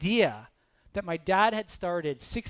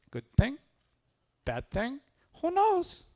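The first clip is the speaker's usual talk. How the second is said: higher, questioning sage with slight put-on accent; lightly voiced wise farmer; 9 semitones higher than usual. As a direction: weigh each option with a rising lilt, pausing between.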